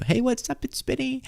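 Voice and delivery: high, thin hyped imitation of a video intro; Benny Johnson intro. Podcaster imitating Benny Johnson's opening line. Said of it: "That's what Benny Johnson says every time he starts a video".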